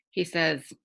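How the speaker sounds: ordinary delivery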